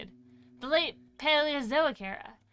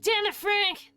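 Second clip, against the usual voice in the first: exasperated shout; 7 semitones higher, 3 dB louder, slower than usual. Pretend. Repeating the shout louder.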